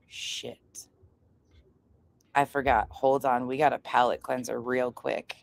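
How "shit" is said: breathy whispered oh shit under the breath; whispered curse. Real, not acted. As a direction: swear under your breath, voiceless. Muttered reacting to message.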